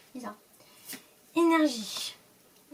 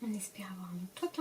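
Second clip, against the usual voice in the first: a little secret wish over the booster; hopeful whisper; 5 semitones lower, 11 dB quieter, faster than usual. Real, not acted. Opening a booster, whispering a hopeful wish to viewers. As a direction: whisper it quickly, almost to yourself. Hoping for a good card.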